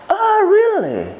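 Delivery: high, drawn-out scoff of disbelief; indignant disbelief. Acted. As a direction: stretch it out high, as if outraged. Scornful reply to rumours about her.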